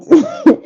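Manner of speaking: a quick high burst of laughter; brief rueful laugh; short laugh. Real, not acted. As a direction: laugh at yourself mid-story. Laughs at own story.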